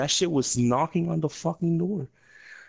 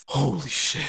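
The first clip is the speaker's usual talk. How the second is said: ordinary talk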